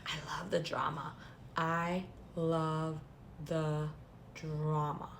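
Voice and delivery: whispered, gleeful aside; a hushed, delighted confession. Real, not acted. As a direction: lean in and whisper it like juicy gossip. Gleeful aside about the feud.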